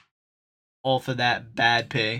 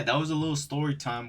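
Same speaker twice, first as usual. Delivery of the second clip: usual speaking voice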